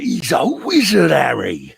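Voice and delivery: a hint of Hagrid, lightly voiced; light Hagrid impression; Hagrid quote. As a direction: toss off the famous Hagrid line in a light put-on voice. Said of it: "Hagrid there, giving it the beans".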